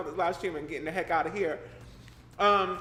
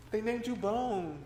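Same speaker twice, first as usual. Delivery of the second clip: slow, higher, a playful gasp; mock gasp; 6 semitones higher, 4 dB quieter, slower than usual. Real, not acted. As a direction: fake shock at the chat. Reacting to chat name.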